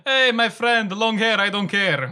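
high, loud, slow tourist-English catchphrase; hammed-up tour-guide patter; tourist English joke. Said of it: "would just end in".

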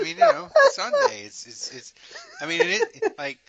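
squeaky high voice. Acted. Mock high voice riff.

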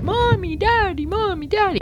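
put-on high, childlike calls for parents; mock little girl crying out for mommy; scared child calling. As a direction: call out like a frightened little kid.

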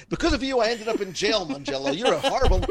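hammed-up accusing tone; mock accusation. Shown as a demonstration. An angry listener blaming the host, mock outraged. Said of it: "as long as it's a positive".

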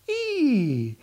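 very high, triumphant squeal; the little deer squealing as it finally reaches ten; excited deer squeal. A narrator's reading. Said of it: "he gets all excited".

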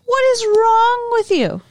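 high, drawn-out mock exasperation; retold indignant outburst. Student president scolds party host, mock exasperated. Retells Brandon's outraged reaction at party.

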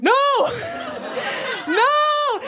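high, hammed-up cry of dismay; high mock-horrified wail. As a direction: plead in a squeaked, comic panic. Horrified as girlfriend leaves, acted.

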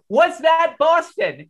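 high, stretched-out frantic question; acted-out panicked question. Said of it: "I grab the usher frantically".

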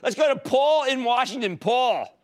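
high, energetic caller introduction; calling out the caller. Real, not acted. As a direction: holler for the next caller. Calls the next caller on air.

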